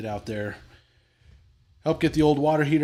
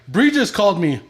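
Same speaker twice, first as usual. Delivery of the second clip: loud, high mock complaint; imitating angry son; 8 semitones higher, 9 dB louder than usual. Son yelling that his wife just called. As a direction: squeal it like an outraged son. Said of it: "He's like".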